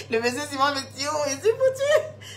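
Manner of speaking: a mock-terrified wail, mostly not real; mock panic. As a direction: ham up the fear of being killed. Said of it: "Il a dit, eh".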